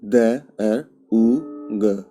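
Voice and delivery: each letter drawn out slowly; slow letter-by-letter spelling. Shown as a demonstration. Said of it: "spelling out the word".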